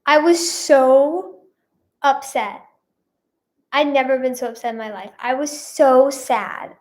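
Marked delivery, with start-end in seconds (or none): none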